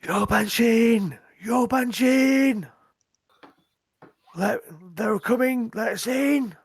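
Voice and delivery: high, loud drawn-out cries to be let in; urgent shouting. Acted. Rider to the gate guards, shouting in alarm. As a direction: yell the alarm up at the gate, stretching the names. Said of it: "starts shouting".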